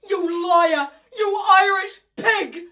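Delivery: loud high slow insult; furious shout. Acted. Mother screaming insults at the housekeeper.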